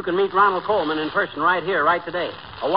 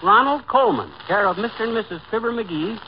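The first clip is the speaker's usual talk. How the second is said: higher, excited telegram read-out; 3 semitones higher than usual. Acted. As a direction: read the wire aloud, amazed. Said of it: "It says".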